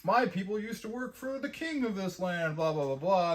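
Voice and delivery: breezy fake voice rattling off a backstory; mock boat stranger. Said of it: "they're like".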